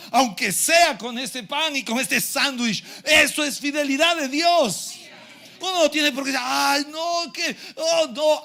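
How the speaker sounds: high, forceful pulpit delivery; rousing, emphatic sermon voice; fervent preaching. Real, not acted.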